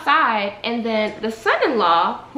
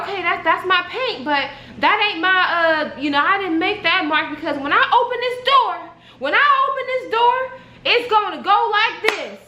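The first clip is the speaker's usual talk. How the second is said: high, insistent mock protest; agitated defence; 7 semitones higher than usual. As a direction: imitate her pleading her case, pitch climbing. Acts out woman's agitated defence.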